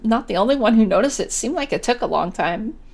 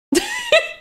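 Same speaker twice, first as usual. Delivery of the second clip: high squealing laugh. Real, not acted. Laughing at Susie.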